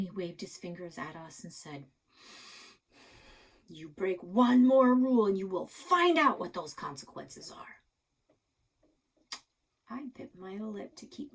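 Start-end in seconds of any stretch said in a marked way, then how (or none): 3.7-7.8 stern warning. Acted. Grandpa warns, wagging fingers.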